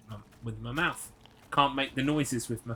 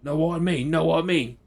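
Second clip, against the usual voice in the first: higher, louder Cockney "know what I mean", dropped t's; full-on Cockney; 4 semitones higher, 6 dB louder than usual. Retold or imitated. Imitating Auntie Dina's full Cockney for the viewer. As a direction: ham up a proper Cockney auntie. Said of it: "a full-on Cockney".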